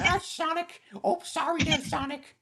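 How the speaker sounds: high comic Midwest farmhand voice; Midwest accent. Pretend. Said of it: "imagine what Jet would sound like with a Midwest accent".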